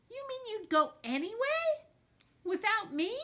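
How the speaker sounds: high, incredulous question; hurt disbelief. Acted. Girl on the phone asking if her best friend would go without her. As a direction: sound stung that she'd go anyway.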